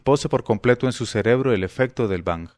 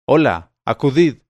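louder call, leaning on acudid; 2 semitones higher, 6 dB louder than usual. Acted. Abul Hasán calling his slave girls again, loud. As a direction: summon them loudly. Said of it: "llamó en alta voz".

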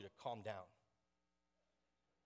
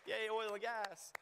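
slow, higher mock cheer; 9 semitones higher, 4 dB louder, slower than usual. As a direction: lead the crowd in a drawn-out fake cheer. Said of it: "You could clap".

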